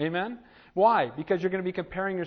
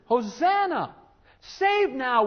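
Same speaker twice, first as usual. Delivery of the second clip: high, ringing cry of praise; crowd acclaim; 7 semitones higher, 4 dB louder, much slower than usual. Acted. Preacher voicing the Palm Sunday crowd, calling out. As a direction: call it out to a passing king. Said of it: "what were they saying?".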